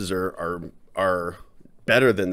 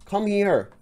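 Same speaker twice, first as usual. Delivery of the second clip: higher pet-calling voice; imitating calling the dog; 13 semitones higher than usual. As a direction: coax the dog over in a high pet voice.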